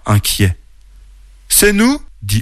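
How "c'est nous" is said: small, high-pitched reply; timid answer. Acted. Worried Oreille answers the forest guardian, high voice. Bear answers the stern owl.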